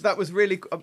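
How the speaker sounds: usual speaking voice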